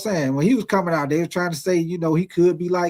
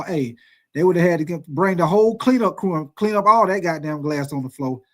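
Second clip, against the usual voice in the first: ordinary talk, nothing marked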